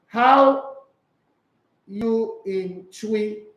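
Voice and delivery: slow, careful try at the phrase; trying Twi. Shown as a demonstration. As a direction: spell out the Twi slowly like a learner. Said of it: "Ado taught me this".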